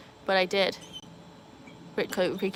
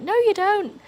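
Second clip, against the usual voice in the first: mock chorus; 13 semitones higher, 8 dB louder, slower than usual. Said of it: "everyone was like".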